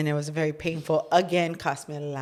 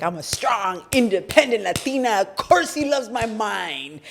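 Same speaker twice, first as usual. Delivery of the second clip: mock proud; 6 semitones higher, 4 dB louder than usual. Wife answering husband who calls her naive: mock-proud declaration. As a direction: puff yourself up, half joking.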